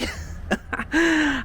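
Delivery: short burst of laughter; laughing. Real, not acted. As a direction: laugh it off quickly. Laughs at his own sarcasm.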